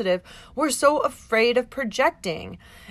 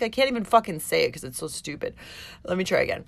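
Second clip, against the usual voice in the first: ordinary delivery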